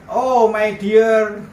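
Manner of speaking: very slow, drawn-out syrupy "Oh, my dear"; mock sweet reply. Victim mocking the scammer's endearment, slow and sugary. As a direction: parody the caller's honeyed tone back at him. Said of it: "saya jawab ganti karena dia my dear".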